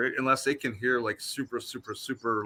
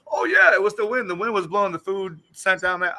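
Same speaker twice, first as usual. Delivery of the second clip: mock certainty; 6 semitones higher than usual. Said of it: "I'm not gonna sit here like".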